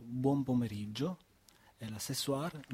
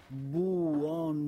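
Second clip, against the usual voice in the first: "buongiorno" drawn out slowly, every syllable clear; teacher modelling "buongiorno" slowly; slow model word; 3 semitones higher, 4 dB louder, much slower than usual. Shown as a demonstration. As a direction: say "buongiorno" slowly so children can copy. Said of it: "ça veut dire".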